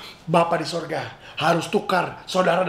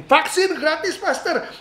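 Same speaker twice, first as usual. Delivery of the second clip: mock congregant objection; 9 semitones higher than usual.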